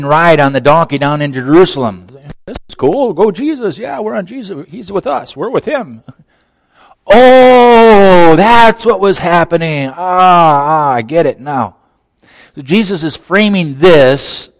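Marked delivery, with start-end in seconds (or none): 2.5-6.0 mock giddy fandom. Disciples cheering Jesus into Jerusalem, mock giddy excitement. 7.1-11.8 loud, high drawn-out "oh", settling down by the end; hammed-up aha moment; big dawning realization. Disciples understanding the ride at last, exaggerated realization.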